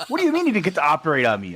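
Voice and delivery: high, rushed, incredulous protest; alarmed disbelief. Retold or imitated. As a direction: blurt it out in mock alarm. Acts alarmed patient to doctor.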